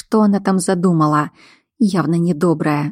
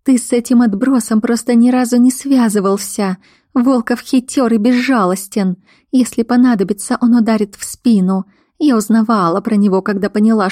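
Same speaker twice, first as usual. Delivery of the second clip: higher-pitched, insistent pleading; alarmed woman; 4 semitones higher, 3 dB louder than usual. Acted. Woman urges the werewolf to flee, insistent. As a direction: press the case urgently, pitch raised.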